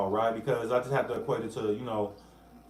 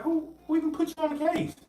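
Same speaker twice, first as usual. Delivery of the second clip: much higher, incredulous squeak; mock incredulous; 14 semitones higher, faster than usual. Retelling his reaction to the hostile court, high-pitched mock incredulity.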